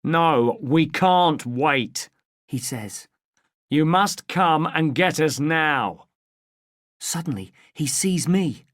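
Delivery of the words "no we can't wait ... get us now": an angry smuggler laying down orders; angry on the phone. A narrator's reading. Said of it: "He is angry".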